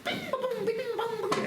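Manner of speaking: high, sing-song imitation of slot machine bells; high mock casino jingles; casino noises and bells. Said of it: "all these noises and bells".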